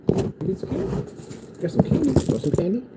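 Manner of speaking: put-on very high, soft baby-talk; mock babying. Vlogger offering candy to haters, mock babying. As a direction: coax a pretend toddler with a treat.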